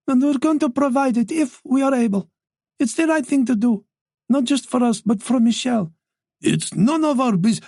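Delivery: put-on firm old mother overriding her husband. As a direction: shut the husband down, kindly but firmly.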